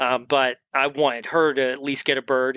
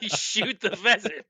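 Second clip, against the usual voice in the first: laughing through a quote; 7 semitones higher, slower than usual. Retold or imitated. Laughing while quoting the guide.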